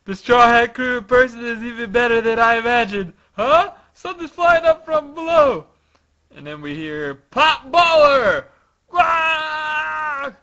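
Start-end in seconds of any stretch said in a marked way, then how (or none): 0.0-5.7 loud, high excited acting; excited fan. Bartolomeo admiring the Straw Hat crew, loud excitement. 7.1-10.4 high, very loud battle cry; shouted attack call. Acted. Attack flying up from below, acted battle shout.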